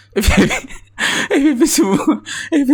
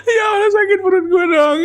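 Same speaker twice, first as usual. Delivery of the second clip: laughing-crying shout; 10 semitones higher than usual. Real, not acted. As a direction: cry it out while laughing helplessly. Can't stop laughing, stomach hurts. (teriak sambil nangis ketawa)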